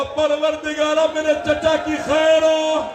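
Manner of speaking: high, slow, sobbing plea to God; a little girl weeping as she prays; weeping prayer. Acted. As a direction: pray through tears for her uncle. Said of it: "रो के कहा".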